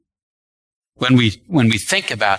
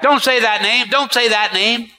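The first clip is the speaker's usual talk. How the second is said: mock protest from devils, pitched high; devils yelling back; 10 semitones higher than usual. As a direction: imitate pleading demons in a high, lightly raised voice. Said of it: "they'd yell back".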